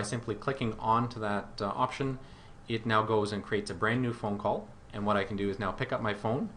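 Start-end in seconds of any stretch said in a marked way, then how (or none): none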